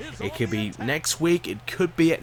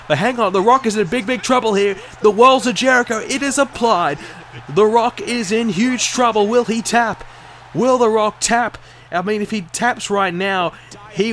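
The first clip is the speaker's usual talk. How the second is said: urgent play-by-play, higher and louder; wrestling commentator; 6 semitones higher, 5 dB louder than usual. Acted. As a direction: call the hold like ringside commentary. Live match commentary.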